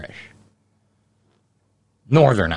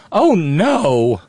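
high, loud dismayed oh no; the shock of seeing the truck again; dismayed gasp; 7 semitones higher, 5 dB louder than usual. Retold or imitated. Daughter-in-law seeing the pig truck, high dismayed cry. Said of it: "she looked over and went".

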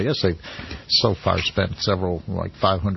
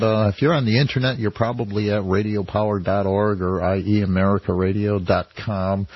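ordinary talk

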